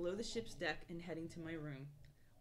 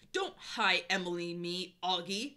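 angry; 7 dB louder, slower than usual. Acted. Angry Emily line read.

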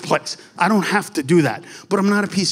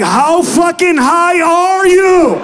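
slow, high, loud disbelief; acted friend's drawn-out outraged shout; incredulous yell; 10 semitones higher, 5 dB louder, much slower than usual. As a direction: yell it, stretching out every word.

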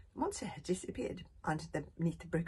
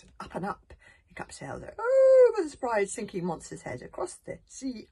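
dramatic; 5 semitones higher, 11 dB louder than usual. A narrator's reading. Dramatic flight in story.

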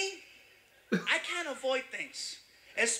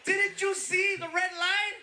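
high, drawn-out incredulous shout; outraged yell; 10 semitones higher than usual. Retold or imitated. Passenger scolds driver over running the light, outraged yell. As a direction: yell it slowly in disbelief. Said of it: "Do you want to yell".

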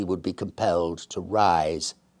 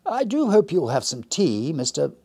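husky voice; 7 semitones higher than usual. Acted. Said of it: "husky little voice".

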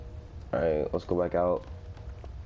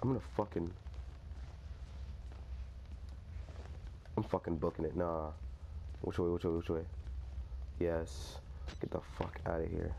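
much softer, urgent fleeing mutter; hushed panic; 3 semitones lower, 14 dB quieter, faster than usual. Real, not acted. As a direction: keep quiet so it won't hear. Hiding and fleeing quietly.

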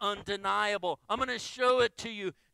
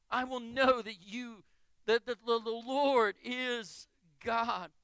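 ordinary talk

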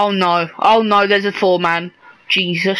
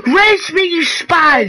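frantic yell; 7 semitones higher than usual. Real, not acted. Begging teammate for revive.

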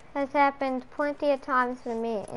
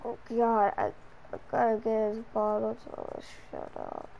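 soft, fading tired grumble; acted weary parent; groggy grumbling; 2 semitones lower, 5 dB quieter than usual. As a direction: grumble as if half asleep. Tired parent getting up for bottle.

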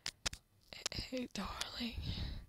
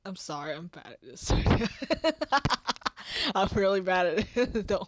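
laughing; 10 dB louder, faster than usual. Real, not acted. Bursting into embarrassed laughter.

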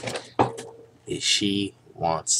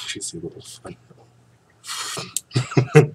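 laughing; 7 semitones higher, 4 dB louder than usual. Real, not acted. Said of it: "laughs repeating it".

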